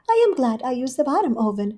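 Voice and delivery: a prim hostess pleased with herself; Rivy's voice. Acted. Rivy talking to herself at home, lightly voiced.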